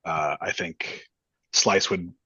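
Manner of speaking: ordinary talk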